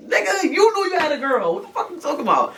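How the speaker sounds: retold furious comeback; angry retort. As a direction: call him out in a rage. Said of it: "I'm like".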